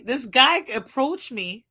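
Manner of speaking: ordinary talk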